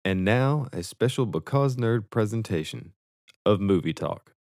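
slow, show-announcer read; announcer intro. Acted. Introducing the movie talk segment, slow announcer read. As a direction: present the segment like a broadcast.

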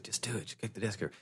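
hushed unvoiced urging; whisper. Robin whispering urgently to Superman. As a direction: whisper it insistently.

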